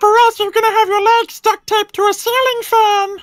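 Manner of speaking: very high squeaky cartoon voice; squeaky Time Wizard voice. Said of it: "my demon voice versus the Time Wizard".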